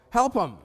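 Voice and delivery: Help 'em, high and loud; emphatic urging. Retold or imitated. Banker urging colleagues about customers, Help 'em high and forceful.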